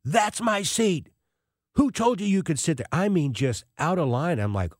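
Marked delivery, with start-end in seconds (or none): 0.0-2.9 retold screaming. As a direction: imitate an irate man yelling, pitched high. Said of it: "starts screaming at her".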